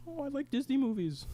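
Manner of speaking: mocking opponents in a high whine. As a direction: sneer in a high silly voice. Mocking the Coomers.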